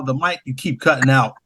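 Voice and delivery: ordinary talk, nothing marked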